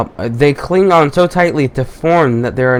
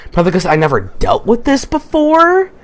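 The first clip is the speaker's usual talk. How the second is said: usual speaking voice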